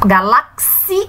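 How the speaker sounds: slow "galáxia", broken up into galá, xi, a; last syllable separated. Shown as a demonstration. Teacher showing which syllable is last, slow and broken up. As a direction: pull apart the end of "galáxia". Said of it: "porque a última é a".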